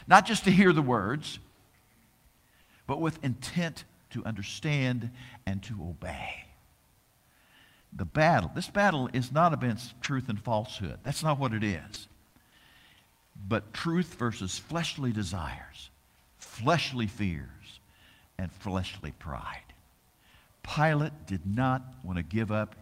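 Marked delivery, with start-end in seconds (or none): 2.9-6.5 whispered, earnest point. Real, not acted. Preacher urging listeners to obey, in a whisper. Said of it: "whispered point in sermon". 13.4-19.7 slow, lower, softer list; slow and fading. Real, not acted. Preacher naming fleshly desires, slow and fading. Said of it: "slowing, fading list".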